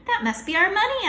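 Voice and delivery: high, loud shout of hope; thrilled kid shouting; excited shout. Acted. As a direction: shout it at the window. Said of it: "I shouted".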